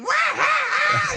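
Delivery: huge high, drawn-out mock guffaw; Patrice laugh. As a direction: shout out an imitated belly laugh. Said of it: "He's doing the fucking Patrice laugh".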